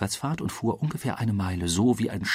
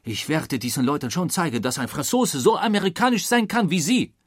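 high, worked-up declaration; defiant; 7 semitones higher, 3 dB louder than usual. A narrator's reading. Passepartout proving a Frenchman as American as them, defiant. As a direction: rise to the challenge, stung.

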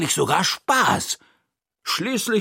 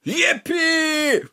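high, whooping cheer; joyful scream. Acted. Narrator so happy Toni will play, joyful scream. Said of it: "schreie ich".

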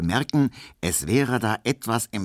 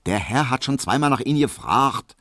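dismissive maid; 6 semitones higher than usual. A narrator's reading. Hedwig voiced by narrator.

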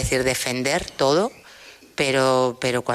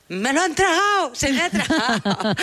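grito; 12 semitones higher than usual. Retold or imitated. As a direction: re-enact a panicked cry for help, very high-pitched. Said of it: "Pues yo empecé a gritar". (grito agudo y teatral)

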